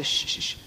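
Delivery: soft unvoiced shushing; hushing shh. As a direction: hush them like a fussy child. Pastor voices Paul hushing.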